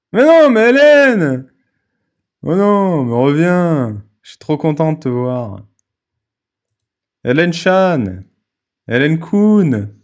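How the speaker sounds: drawn-out, high, loud pleading wail; mock begging. Host begging Hélène to come back to the call, mock pleading. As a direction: call her back in hammed-up desperation. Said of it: "j'aime bien qu'on me supplie".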